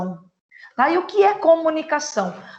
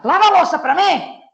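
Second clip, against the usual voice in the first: louder and higher, a scolding order; sharp loud command; 5 semitones higher, 5 dB louder than usual. Shown as a demonstration. Teacher demos scolding her son to wash dishes, loud. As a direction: bark the order at your son. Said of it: "A entonação muda".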